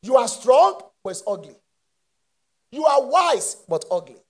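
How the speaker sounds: usual speaking voice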